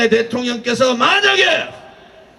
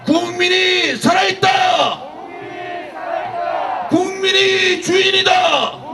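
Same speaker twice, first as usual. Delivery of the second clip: rally shouting; 5 semitones higher than usual. Real, not acted. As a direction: roar it at the crowd. Rally speaker shouts slogans. (군중을 향해 외치듯)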